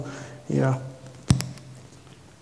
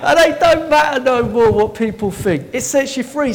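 high, loud exultant preaching; real excitement; 7 semitones higher, 8 dB louder than usual. Real, not acted. Preacher tells church other people's opinions don't matter, excited. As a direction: proclaim it with elated force. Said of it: "Well, I'm excited".

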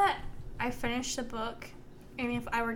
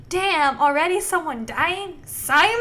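shocked exclamation; 3 semitones higher, 10 dB louder than usual. Retold or imitated. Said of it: "'cause I wrote down".

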